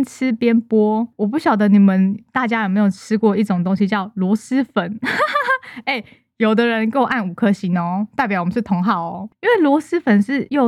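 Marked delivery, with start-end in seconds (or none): 5.0-5.8 high-pitched giggle; short burst of high giggling. Real, not acted. Podcaster amused after naming snail noodles, giggling.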